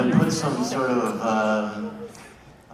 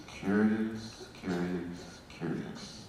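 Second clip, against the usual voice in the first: curious echoing away softer; fading echo; 3 semitones lower, 9 dB quieter, much slower than usual. Acted. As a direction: let it fade like an echo.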